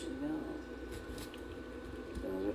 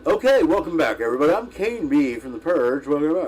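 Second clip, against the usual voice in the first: louder, hammed-up announcer welcome; radio announcer voice; 2 semitones higher, 5 dB louder than usual. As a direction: play the radio host back from the break. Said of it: "radio announcer voice".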